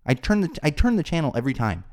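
usual speaking voice

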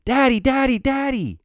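high-pitched mock scream of a frightened kid; mock screaming for a parent; imitated child's scream. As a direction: imitate a three-year-old crying out, voice way up. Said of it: "starts screaming".

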